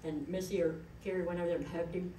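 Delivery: ordinary talk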